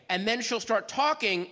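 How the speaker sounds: usual speaking voice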